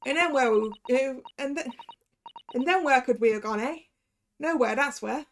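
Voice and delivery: lightly put-on Cockney accent, confessing; a street girl's Cockney, owning up; Gina's Cockney. Gina confessing her false testimony, light Cockney accent. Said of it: "you think the Cockney is better".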